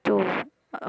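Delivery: usual speaking voice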